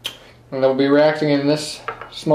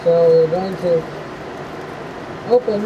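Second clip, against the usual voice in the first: muffled, speaking through a respirator mask; 3 semitones higher, 4 dB quieter than usual. Real, not acted. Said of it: "I'm all geared up in the respirator".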